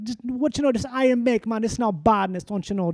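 joke-telling in a put-on Jamaican patois; Jamaican accent. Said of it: "I basically do a Jamaican accent".

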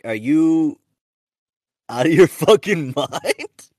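drawn-out mock disbelief, breaking into a laugh; incredulous. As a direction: stretch it out, rising in pitch and volume. Retold incredulous reaction to friends.

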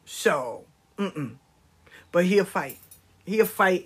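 usual speaking voice

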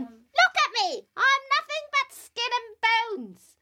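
put-on high, quick old lady voice; old woman voice. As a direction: squeak it like a frail granny. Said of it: "said the old woman".

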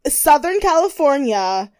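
normal talking voice

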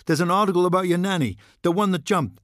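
high, fast, pushy reporter voice; brash press hound pouncing on a diplomat. Acted. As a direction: fire the question at him, eager and fast. Reporter firing questions.

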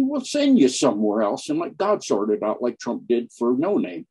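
usual speaking voice

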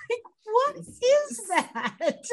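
high, slow cry of disbelief; incredulous outcry. Real, not acted. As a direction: stretch it out in mock outrage. Baffled by the calendar scene.

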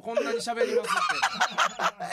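high, slow mocking voice; 高い声でゆっくり茶化して真似する; mock imitation. Mimicking co-host's talkative claim. (相方の口調を高くゆっくり真似てからかう)

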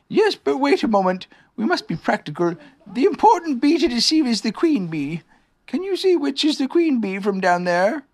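high-pitched, earnest bear; Pooh bear, practical. Acted. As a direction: take charge as a high-voiced bear.

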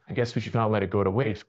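ordinary delivery

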